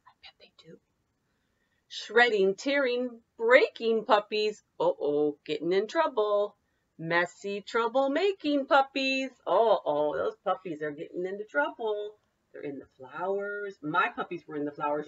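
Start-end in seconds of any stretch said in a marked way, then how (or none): 0.1-0.8 soft conspiratorial whisper; hushed aside to the class. Real, not acted. Aside to children. 1.9-12.1 lively singsong picture-book read; bouncy read-aloud for children. Acted. Teacher reads picture book aloud.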